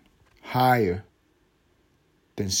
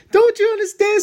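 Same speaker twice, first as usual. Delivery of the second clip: mock pleading yell; 7 dB louder than usual. Repeating it even more.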